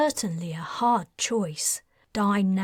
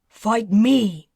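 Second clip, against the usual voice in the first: louder, hurled challenge; insistent challenge; 8 dB louder than usual. Acted. Patrin challenging Merlin, insistent and loud. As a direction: demand the fight. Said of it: "Patrin insisted".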